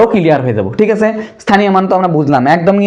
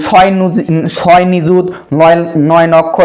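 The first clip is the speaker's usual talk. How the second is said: slow, place-by-place number reading; mistaken number reading; 2 semitones lower, slower than usual. Shown as a demonstration. Teacher showing pupils a wrong reading, slow and piece by piece. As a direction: sound out each place value separately. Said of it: "তাহলে আমরা কি কখনো বলি".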